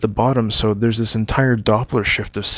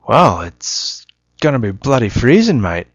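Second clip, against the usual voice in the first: put-on Australian accent; 3 semitones higher, 3 dB louder than usual. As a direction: put on a broad Aussie accent, half-remembered. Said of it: "I can't actually remember my Australian accent".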